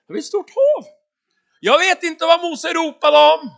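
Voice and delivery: loud, very high-pitched exclamation; exasperated protest. Acted. Preacher arguing about the impossible sea, exasperated. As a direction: protest at the top of the voice.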